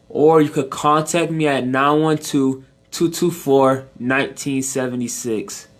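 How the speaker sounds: slow, careful contact details; measured pace, each part set apart. Real, not acted. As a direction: give it slowly enough to write down. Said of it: "say it a little slower now".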